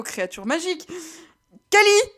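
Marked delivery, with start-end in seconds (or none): 1.7-2.1 Kelly lancé fort et haut pour couper la parole; coupe-la net en criant; interruption criée. Acted. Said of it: "le coupa Liam". (Liam coupe Kelly en criant son nom)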